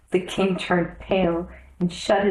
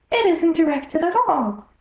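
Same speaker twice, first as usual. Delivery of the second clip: much higher, fussy rabbit voice; anxious little rabbit pleading to the king; 10 semitones higher, 4 dB louder than usual. A narrator's reading. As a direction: squeak it out in a flustered hurry.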